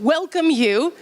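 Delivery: normal talking voice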